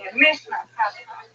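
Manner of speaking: ordinary delivery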